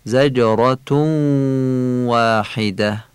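زجراتون held and merged into the waw of واحده; prolonged nasal merge. Shown as a demonstration.

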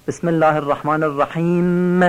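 الرحیم with the last m held and hummed, "rahimmm"; wrongly prolong the closing m in الرحیم; overdoubled final letter. Shown as a demonstration. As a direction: press too hard on the final m of الرحیم.

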